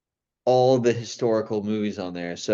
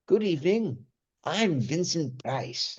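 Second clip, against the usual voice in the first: slow, higher, hammy horror host; Vincent Price impression; 5 semitones higher, 5 dB quieter, slower than usual. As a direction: welcome them like a creepy old host. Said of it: "he ended up doing".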